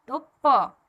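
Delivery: प said loud, showing its अ sound; demo syllable. Shown as a demonstration. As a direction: model प clearly for the class. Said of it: "प का भी sound आ रहा है".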